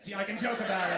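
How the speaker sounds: ordinary talk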